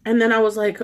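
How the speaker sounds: normal talking voice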